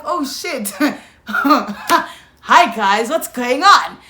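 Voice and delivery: retold greeting through laughter; laugh, then greet the crowd loudly; caught-out hello, cracking up. Retold sheepish greeting to arriving squad.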